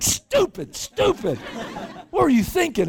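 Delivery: high, laughing-crying scold; half-laughing angry shout; scolding through tears. Retold or imitated. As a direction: tell him off while shaking with relief. Beating on driver's helmet, tears.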